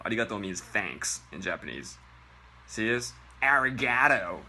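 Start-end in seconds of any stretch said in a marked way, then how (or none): none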